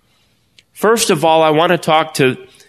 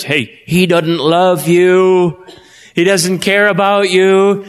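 slow, sly taunt from the devil; Satan's taunting voice; 3 semitones higher, slower than usual. Retold or imitated. Pastor voicing Satan taunting a believer, a slow sly taunt. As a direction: drag it out like the tempter mocking. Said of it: "When Satan's whispering in your ear and says".

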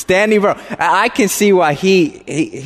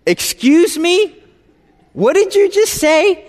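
shocked disbelief; 11 semitones higher than usual. Retold or imitated. Son stunned by his mom's news, high and disbelieving. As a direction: react as if the floor dropped out.

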